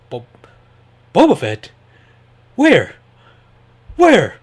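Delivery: high, loud hammed-up yell; mock panicked cry, pitch soaring; lame impression. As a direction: shout it way up high, deliberately cheesy. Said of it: "That was my lame impression".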